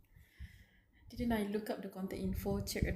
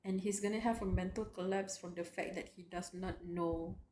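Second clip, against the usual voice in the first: normal talking voice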